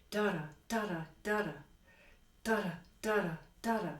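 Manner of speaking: "da-da" with the first syllable accented, falling; soft groups of three "da-da", each leaning on the first. Shown as a demonstration. Teacher showing every note pair's accent, "da-da" stressed first. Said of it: "Everyone has accents".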